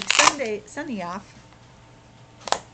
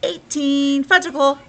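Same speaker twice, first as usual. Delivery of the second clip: playful shout; 5 semitones higher, 9 dB louder than usual. Acted. Silly playful counting joke.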